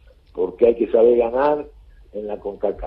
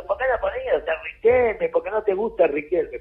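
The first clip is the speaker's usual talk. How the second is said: retold yell; 6 semitones higher than usual. Coach imitating a passing cyclist heckling him, mild mock yell. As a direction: imitate a heckler's cry without really shouting. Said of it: "me grita".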